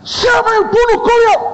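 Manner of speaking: high loud demand; booming question. Retold or imitated. Founder pressing the student again, loud and high.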